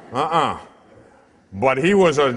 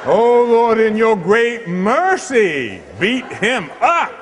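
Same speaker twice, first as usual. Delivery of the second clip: higher, louder and slow, like a grand plea; hammed-up loud prayer; 7 semitones higher, 6 dB louder, slower than usual. Priest praying loudly that God beats up the wise guy. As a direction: belt it out as a mock-solemn appeal.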